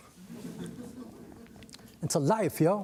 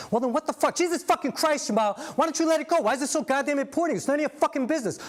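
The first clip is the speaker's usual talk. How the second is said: angry yelling; 9 semitones higher than usual. Acted. Esther shouting at Jamal to drop the subject, furious and high. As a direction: blow up at him. Heated fight about stepfather.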